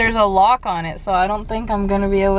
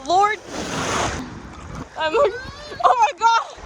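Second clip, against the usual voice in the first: excited shriek. Real, not acted. Getting into the ocean water.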